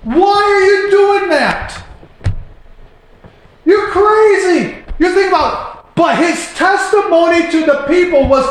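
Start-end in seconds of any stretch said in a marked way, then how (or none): 0.0-4.8 high, slow jeering voice; the world mocking Noah, high and drawn out. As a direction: scoff at the boat-builder. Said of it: "the world's saying".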